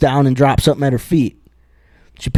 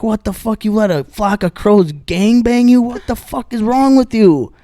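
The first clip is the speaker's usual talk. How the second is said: angry husband; 8 semitones higher than usual. Retold or imitated. Husband confronts wife.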